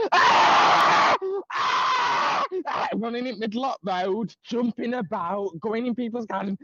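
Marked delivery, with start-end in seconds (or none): 0.1-2.9 very high mock screams; imitated screaming. Guest imitating a man screaming in the street, screaming. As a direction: scream repeatedly, imitating a meltdown. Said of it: "Like, proper, like, screaming".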